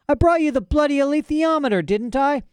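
put-on young Lyra snapping at her father, close to crying; bursting out, near tears. A narrator's reading. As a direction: blurt it out, fighting back tears. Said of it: "Lyra burst out".

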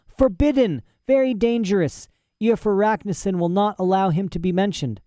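put-on a touch of fear in the old professor; frightened warning. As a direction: warn her, scared of being overheard. Frightened old man warns.

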